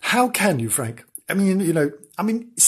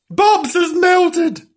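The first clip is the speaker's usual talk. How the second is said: slow alarmed wail; 5 dB louder, much slower than usual. Acted. Urgent news to Rosie.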